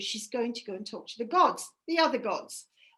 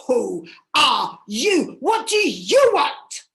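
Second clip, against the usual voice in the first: a wild, grieving sister turning on a stranger; furious challenge; 7 dB louder, slower than usual. Acted. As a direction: hurl the questions at the intruder. Grieving sister confronts Inanna.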